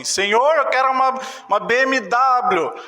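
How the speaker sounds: high-pitched, hammed-up plea to God; mock demanding prayer. Preacher imitates believer asking God for car, mock plea. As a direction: play a believer begging for a luxury. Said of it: "e você falar assim".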